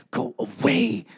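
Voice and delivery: a light imitation of an irritated sister; annoyed brush-off. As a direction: wave her off, irritated. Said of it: "kinda annoyed".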